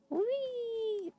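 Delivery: high squeal of fun; a gleeful high whee; high excited whee; playful whee. Real, not acted. Playful squeal while moving.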